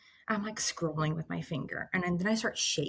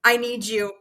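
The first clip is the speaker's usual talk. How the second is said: retold urgent plea, raised and louder; urgent call for help, contained; freaking out; 4 semitones higher, 8 dB louder than usual. Telling her husband she needs him, freaking out. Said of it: "I start freaking out".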